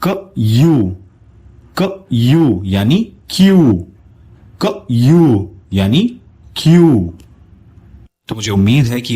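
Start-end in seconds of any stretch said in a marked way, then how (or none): 0.0-2.6 क यू said as क then यू; sounds split apart. Shown as a demonstration. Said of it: "इसका sound बनेगा". 4.6-5.5 क यू said as क then यू; sounds split apart. Shown as a demonstration. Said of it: "इसका sound बनेगा".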